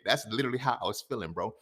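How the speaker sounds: usual speaking voice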